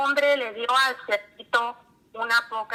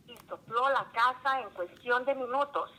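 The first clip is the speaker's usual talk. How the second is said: plain reading voice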